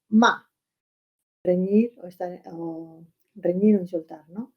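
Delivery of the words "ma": short, loud falling MA; fourth tone. Shown as a demonstration. Teacher giving word for scolding, loud descending "ma". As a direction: drop "ma" sharply as if angry. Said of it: "en cuarto tono".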